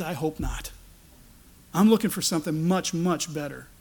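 ordinary talk

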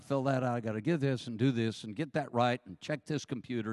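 ordinary delivery

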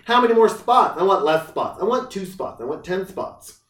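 high, loud fussy customer; demanding snob. Retold or imitated. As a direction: whine like a picky rich buyer. Voicing picky buyers.

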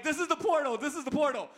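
high, urgent thrilled repeat; excited dad. Retold or imitated. Dad points out the portal to Liam, excited. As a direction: hype the ride to your son. Dad hyping son on ride.

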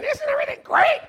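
very high sing-song cheer; cheery wife voice. Retold or imitated. Pastor Alicia cheerful in a hurricane, very high mock voice. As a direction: chirp it like all is fine in a storm.

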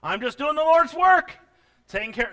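loud, high-pitched mock protest; mock voice of someone excusing themselves; self-righteous believer. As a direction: blurt it out as a self-justifying churchgoer. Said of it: "Now some are thinking".

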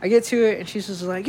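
usual speaking voice

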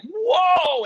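loud high whoa; mock amazed crowd. Imitating the crowd seeing the giant bullfrog, loud and amazed. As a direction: belt it like an awed audience. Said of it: "people are like".

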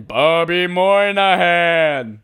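booming, high, slow name callout; hammed-up late-night show intro call; SNL announcer voice. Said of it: "We should have an S N L intro".

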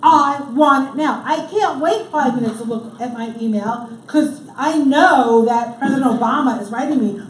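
hammed-up urgent craving, loud and building; frantic addict. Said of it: "feels like the heart of addiction".

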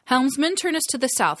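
high, loud command across the deck; barking orders. Acted. Captain commanding the helmsman, barking it loud and high. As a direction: bark it to the helm. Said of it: "barking orders to the rest".

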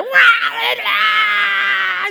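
loud high mock wailing of a child; hammed-up child's cry. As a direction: wail like a thrashing boy. Boy crying out, possessed.

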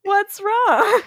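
high sweet voice breaking into laughter; laughing mock sweetness. Retelling a TV scene to her co-host, high and giggling. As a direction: coo it, then lose it to giggles. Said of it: "[name] voiced in recap".

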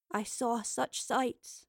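soft, slow, faraway wonder; hushed, drawn-out recollection; quiet. A narrator's reading. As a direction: say it quietly and slowly. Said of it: "said Evie quietly".

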